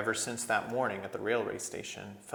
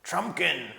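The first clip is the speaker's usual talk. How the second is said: dwarf voice; 7 semitones higher, 5 dB louder than usual. A narrator's reading. Dwarf answers the sentinels.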